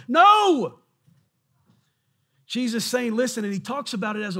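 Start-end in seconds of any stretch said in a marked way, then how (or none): 0.1-0.8 loud, high sharp no; shouted no. Acted. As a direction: slam the door on the excuse. Preacher rejecting scared excuses.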